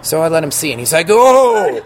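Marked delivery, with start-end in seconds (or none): 1.1-1.8 a high, loud startled "Oh!"; shocked exclamation. Retold or imitated. Manager seeing the gashed eyebrow, loud startled gasp.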